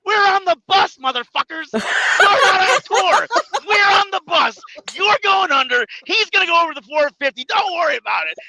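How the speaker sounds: loud, high, laughing yell at the jobbers; shouting. Retold or imitated. Retelling partner Vito yelling at the young wrestlers, loud and laughing. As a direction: bellow it, half laughing.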